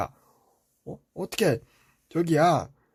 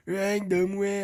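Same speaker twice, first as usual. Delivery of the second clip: higher, whiny crybaby imitation; mock whining; 4 semitones higher, slower than usual. Older brother mocking his younger brother's complaint, in a crybaby whine. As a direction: mimic a whimpering little complainer. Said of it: "하고 징징 짜".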